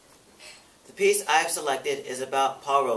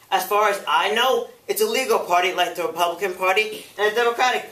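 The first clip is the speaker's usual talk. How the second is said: impassioned challenge, higher and building; indignant witness; 6 semitones higher, 6 dB louder than usual. Acted. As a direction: turn the question back on the accusers with force. [name] challenges the committee's question.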